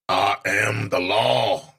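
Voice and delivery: slow, low mock TV judge voice. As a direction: pronounce it like a stern TV judge. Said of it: "Sounds like a T V judge".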